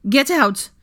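forceful high command; ground out. A narrator's reading. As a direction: throw them out furiously. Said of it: "Hermione ground out".

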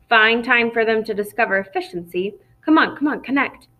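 ordinary delivery

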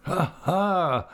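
high-pitched acted "ha, ha"; hearty laugh. A narrator's reading. Peggotty greeting his guests in relief, hearty acted laugh. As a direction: laugh out in character. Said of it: "laughed Mister Peggotty".

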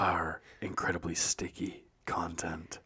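slow, breathy mock whisper; hushed and slow, a naughty secret. Joking about late-night content.

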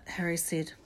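ordinary delivery

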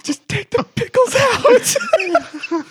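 squeaky high plea, slow and drawn out; high pleading. Retold or imitated. To the angry customer, a slow high pleading squeak. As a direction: beg him in a thin high voice.

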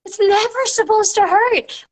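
high, insistent no to a scared client; lifting the voice high to reassure; emphatic reassurance. Retold or imitated. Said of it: "I'm like".

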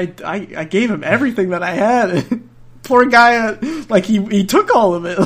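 breaking into high laughter; laughing. Real, not acted. Recalling dad's guilt to the listener, laughing hard. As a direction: laugh through the words.

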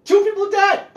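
high, loud disbelieving outcry; outraged exclaim. Real, not acted. Podcaster outraged at a shoveling murder, high exclamation. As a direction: shout the absurdity of it. Outraged about neighbor shooting.

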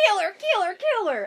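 high, loud mock shriek of kill her; retelling the heroine's bad acting with a mock shriek; mock screaming. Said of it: "She goes".

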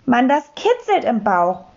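ordinary delivery

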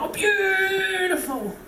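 high, long drawn-out cooing; exaggerated admiration. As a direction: stretch out an over-the-top swoon of approval. Mock admiring the new carpet.

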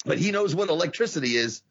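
ordinary talk, nothing marked

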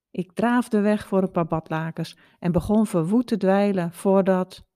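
usual speaking voice

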